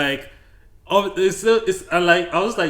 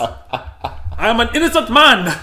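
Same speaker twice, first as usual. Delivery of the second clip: mock innocent protest; 7 semitones higher, 8 dB louder, slower than usual. Real, not acted.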